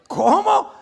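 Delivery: high, loud mock disbelief; incredulous question. King hears of David's plan, incredulous yelp.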